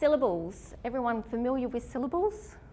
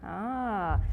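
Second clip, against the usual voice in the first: ordinary delivery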